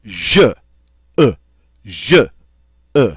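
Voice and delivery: short, explosive French "je"; explosive vowel. Shown as a demonstration. Teacher demonstrating the French word for game, explosive vowel. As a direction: punch "je" and "e" out briefly, no lingering. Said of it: "Notice it's very explosive".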